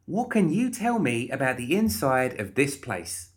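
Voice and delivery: high-pitched, eager questioning; pushy, inquisitive Molly. Acted. Molly questioning the guard, eagerly. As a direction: pitch it up and probe.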